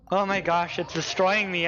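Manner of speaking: ordinary talk, nothing marked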